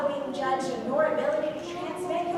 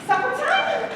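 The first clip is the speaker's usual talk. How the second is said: eager call; 10 semitones higher, 7 dB louder than usual. Acted. Dog asking for dinner.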